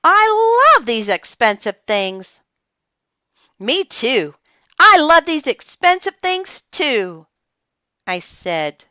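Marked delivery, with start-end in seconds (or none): 0.0-2.4 put-on higher, louder prissy girl; gushing rich girl. Lucille gushing. 3.6-7.3 high, louder eager echo; girl eagerly agreeing with her friend; copycat child. Acted. Narrator copying Lucille.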